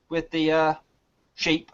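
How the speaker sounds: ordinary talk, nothing marked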